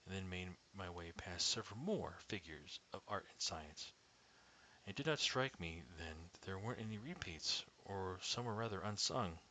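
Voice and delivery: usual speaking voice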